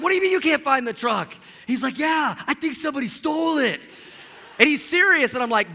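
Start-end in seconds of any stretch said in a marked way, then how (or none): 0.0-1.3 higher, louder incredulous question; can't believe what he's hearing; disbelief. Retold or imitated. Pastor's disbelief on phone. 2.0-3.9 higher, earnest put-on friend voice. Friend says truck stolen.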